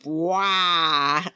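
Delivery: low creature grunt; big grunt. A narrator's reading. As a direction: grunt out a long low "bwah". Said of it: "then grunted".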